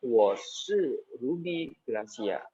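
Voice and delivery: Wo shi Ruby Gracia said very slowly and clearly; teacher modelling the answer slowly; slow example answer. Shown as a demonstration. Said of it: "Kamu jawab pertanyaan".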